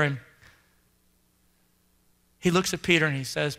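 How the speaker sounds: low, subdued preacher's narration; hushed sermon storytelling. A narrator's reading. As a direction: tell it low and solemn. Hushed story of Peter's betrayal.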